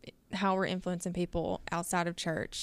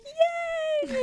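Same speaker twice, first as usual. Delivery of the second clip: high child's cheer, much higher, mildly imitated; excited child's scream; 4 dB louder than usual. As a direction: mimic a toddler's delighted yay. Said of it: "And she was just screaming".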